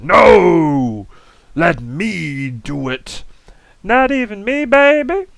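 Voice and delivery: high, loud squabbling cry; excited puppet outburst. Pretend. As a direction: burst in shouting playfully.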